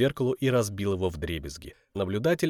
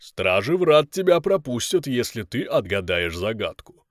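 giant guardian voice; 3 dB louder than usual. Acted. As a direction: pose the riddle like a giant. Giants pose a riddle.

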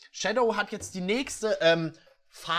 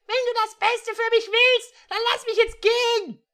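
put-on much higher, louder girl's voice; hammed-up high-pitched heroine pleading; Regine's voice; 8 dB louder than usual. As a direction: play the defiant girl, pitched way up.

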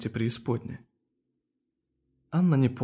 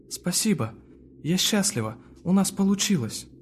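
joyful; 7 semitones higher than usual. A narrator's reading. Anna thanks everyone happily.

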